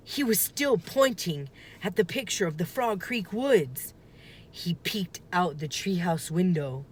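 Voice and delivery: ordinary delivery, nothing marked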